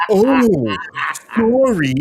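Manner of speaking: high, lightly mocked puppet apology; Telly. Telly apologizes to the kid, imitated puppet voice. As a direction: put on a high, sheepish Muppet voice. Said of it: "And he goes".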